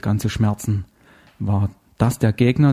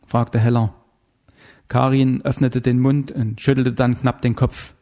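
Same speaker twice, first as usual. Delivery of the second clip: usual speaking voice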